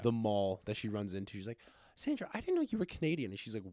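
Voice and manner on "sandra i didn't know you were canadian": high-pitched mock surprise; surprised friend. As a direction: play the amazed friend, voice way up. Friend greeting Sandra at mall, imagined.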